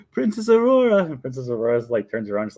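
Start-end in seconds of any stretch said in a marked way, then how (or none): 0.1-1.0 high, loud summons; Mary Poppins. Retold or imitated. Nanny summoning the princess once more, loud high put-on call. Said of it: "then she's like".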